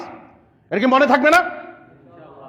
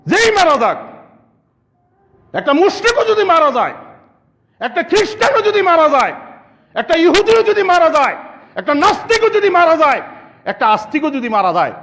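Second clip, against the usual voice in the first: higher, building preacher's call; preaching at full force; 8 semitones higher than usual. Acted. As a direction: drive each line harder like a sermon peak. Sermon climax, rising force. (জোরালো ওয়াজের সুরে, ধাপে ধাপে চড়া)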